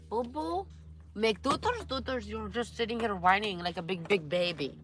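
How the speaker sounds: put-on high sing-song baby talk; whiny man child. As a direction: coo at the dog like a toddler. Baby talk to her dog.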